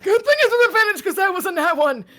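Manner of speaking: high, loud and fast cheer; excited yell. Real, not acted. Player cheering the lucky roll, a fast loud yell. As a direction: shout it out, thrilled at the luck.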